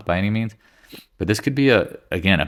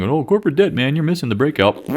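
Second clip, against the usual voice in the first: mocking a critic; 8 semitones higher than usual. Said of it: "I got a lot of flack going".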